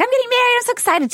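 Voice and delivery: high squealing excited quote; high, thrilled i'm getting married; giddy excitement. Retold or imitated. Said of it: "It's like".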